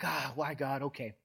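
hushed, grudging prayer, acted out; reluctant surrender. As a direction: give in softly, imitating reluctant obedience. Preacher retold as reluctantly obeying God.

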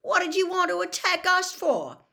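high, loud aggrieved protest; indignant. A narrator's reading. Ron challenges his father over the attack, indignant. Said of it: "looking indignantly at his father".

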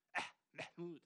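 throaty guttural "Äh" before "Mahmoud"; Arabic guttural äh. Shown as a demonstration. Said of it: "ich liebe bei Arabern das Äh".

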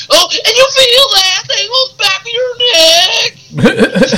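high, slow, wailing mock hillbilly voice; Usos. Host mocking the tag team's switch in promo style, hammed-up hillbilly wail. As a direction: stretch it out in an exaggerated high twang. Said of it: "what in hillbilly gym are you getting into".

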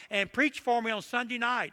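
imitated country pastor, pitched high; country pastor friend. Country pastor asking his friend to preach, imitated high and folksy. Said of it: "That's how he talked".

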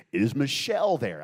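loud radio host on a call; booming radio DJ question. Acted. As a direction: project it like an on-air DJ. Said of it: "I'd put on my D J voice".

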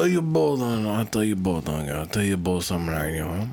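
low put-on Italian accent. Shown as a demonstration. Answering a dare about The Godfather, Italian accent. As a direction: do a movie-mobster Italian accent. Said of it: "you ask me to do an Italian accent".

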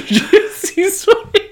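very high, slow laughing repeat; real laughter through the name. Real, not acted. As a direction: crack up saying it.

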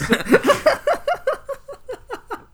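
long squealing laugh way up high; high wheezing laugh. Real, not acted. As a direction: break into a high helpless laugh. Cracking up at Cheerio milk.

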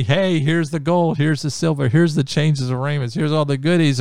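high, loud eager giving; eager offering. Acted. Naaman offering the prophet gold and silver: high loud eagerness. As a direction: push the gifts on him eagerly.

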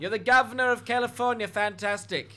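high put-on receptionist voice; humouring the caller. Receptionist asks caller what he does, in high voice.

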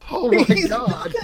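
high, laughing disbelief. Real, not acted. Laughing shock.

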